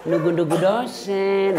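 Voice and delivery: slow lilting giggly reply; mock coy girl. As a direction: drawl it sweetly with a laugh. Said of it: "jangan bikin mendayu-dayu suaramu".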